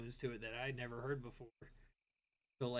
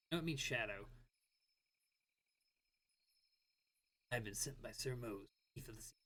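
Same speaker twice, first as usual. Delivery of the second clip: low brooding fake-deep mutter; edgelord voice; 3 semitones lower, 3 dB quieter than usual. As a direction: mutter it dark and moody. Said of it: "Gotta make it my edgelord voice".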